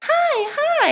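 high, bright-eyed bubbly hi; squeaky cheerful hello; bubbly greeting. Retold or imitated. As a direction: greet like the most bubbly person. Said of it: "I used to smile all the time and like".

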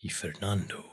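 mock heartbroken Spanish soap actor; soap opera man. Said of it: "in Spanish soap operas".

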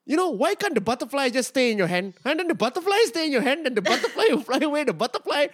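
high, sing-song mock storytelling; mocking imitation of his set. Comic mocking a friend's failed bit, in a high sing-song voice. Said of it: "this is what it sounded like to me".

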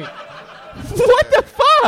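high, drawn-out laughing outrage; laughing disbelief. Real, not acted. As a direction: stretch it out in laughing shock. Mock outrage at joke.

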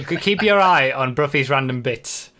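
ordinary delivery

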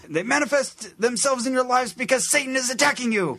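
hammed-up high preacher voice; preacher impression. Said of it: "he's talking like this".

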